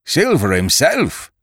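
high, drawn-out astonished cry; startled lookout's slow exclamation; cry of surprise. A narrator's reading. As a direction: cry out slowly in loud surprise. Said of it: "with a cry of surprise".

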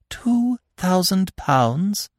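slow, dazed, stunned question; dizzy disbelief. A narrator's reading. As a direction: repeat it as if reeling. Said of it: "she repeated dizzily".

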